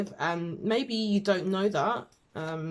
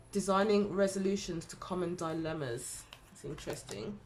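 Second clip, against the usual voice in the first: ordinary delivery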